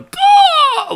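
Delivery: high, loud strangled "gah" of impact; splat cry. Retold or imitated. Bully slamming into the merry-go-round, high pained yelp. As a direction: yelp like someone slammed into metal.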